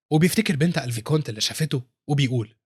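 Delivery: ordinary delivery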